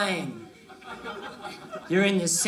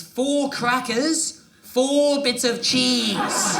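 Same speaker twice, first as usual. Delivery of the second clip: slow, emphatic; much slower than usual. Acted.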